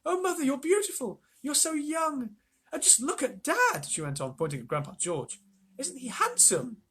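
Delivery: high delighted woman; admiring. A narrator's reading. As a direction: gush over them. Mrs Bucket admiring her parents.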